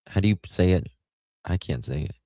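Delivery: ordinary delivery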